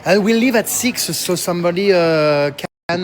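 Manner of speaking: hesitant English with a French accent; real French accent, halting English. Real, not acted. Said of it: "écoutez mon accent anglais".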